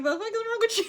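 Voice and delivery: high-pitched quote through laughter; laughing mock outrage. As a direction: imitate their outrage while cracking up. Amused retelling of women's reaction.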